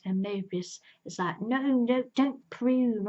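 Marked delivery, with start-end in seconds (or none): 1.4-3.1 louder, higher no, no, don't prune; urgent protest. Retold or imitated. Mobius stopping the officers, louder urgent plea. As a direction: cut in fast to stop them, raised voice.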